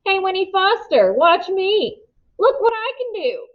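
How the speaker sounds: high, carrying call showing off. A narrator's reading. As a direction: shout across to Winnie, show-off. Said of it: "calling to her".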